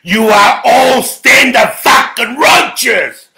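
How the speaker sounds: ordinary talk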